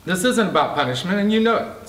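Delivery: rising, barely contained anger; anger held in check. A narrator's reading. J C confronting Casper, restrained anger. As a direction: keep the lid on, but it climbs. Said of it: "trying desperately to hold his anger in check".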